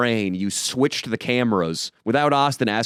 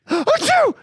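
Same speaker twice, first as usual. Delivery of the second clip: fake sneeze; 4 dB louder than usual. Acting out sneeze fit on date.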